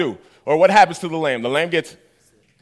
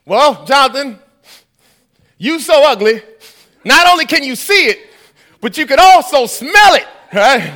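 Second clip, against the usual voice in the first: rising louder and higher jab; building mock taunt; 5 semitones higher, 6 dB louder than usual. [name] roasting his friend, building louder.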